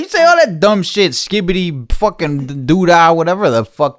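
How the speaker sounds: loud, high mock scolding; teasing yell. Real, not acted. Teasing his son loudly about silly slang.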